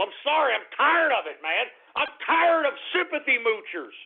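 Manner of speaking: normal talking voice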